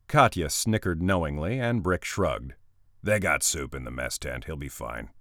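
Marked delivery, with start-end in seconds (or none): 3.0-5.1 big man's voice. Acted. Brick's dry reply.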